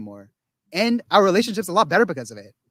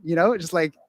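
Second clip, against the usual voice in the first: ordinary talk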